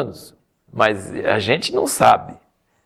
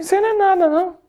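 very high teasing voice; God teasing Moses in a high voice; mock God; faster than usual. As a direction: toss it off like a jokey put-down.

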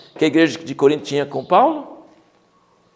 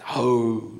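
cave voice; 2 semitones lower, 4 dB quieter than usual. Retold or imitated. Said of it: "só fala aquela voz meio, de caverna".

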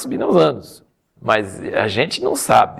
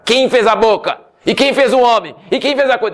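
mock anger; 9 semitones higher than usual. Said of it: "Deus ficou com raiva".

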